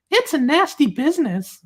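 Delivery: high-pitched mocking falsetto; put-on high voice, poking fun; silly reply voice. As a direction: read it as a squeaky parody.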